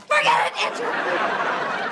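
high, loud exasperated yell; impatient shout; exasperated order. Acted. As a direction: cut him off and order him to the phone. Impatient order over his objections.

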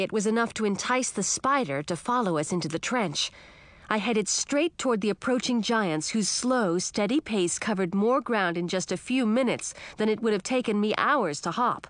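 plain reading voice

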